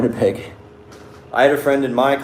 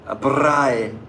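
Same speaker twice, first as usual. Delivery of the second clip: "braai" said higher in a mock South African accent, like they're so proud; imitating South African; 4 semitones higher than usual. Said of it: "they say it like that".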